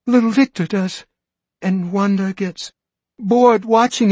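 put-on a mother fearing for her small kids; anxious pleading. Frantic mother explains.

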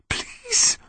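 soft whispered plea. A narrator's reading. Connor pleading to himself, desperate whisper. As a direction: beg under your breath. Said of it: "Connor whispered to himself".

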